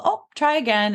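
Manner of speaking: breezy parental nudge to retry; simple light prompt. Shown as a demonstration. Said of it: "Just, like, real simple".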